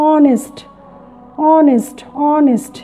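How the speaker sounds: honest said slowly with a silent h, onest; pronouncing honest. Shown as a demonstration. Teacher showing learners the silent h in honest. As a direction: drag out honest as onest, no h sound. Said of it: "খেয়াল করো যে Tumpa was honest girl".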